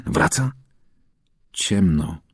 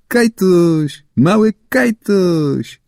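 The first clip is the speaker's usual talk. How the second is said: high, slow sing-song taunt; mock him like jeering kids; boys teasing; 10 semitones higher, 3 dB louder, much slower than usual. A narrator's reading. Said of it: "A chłopcy zaraz".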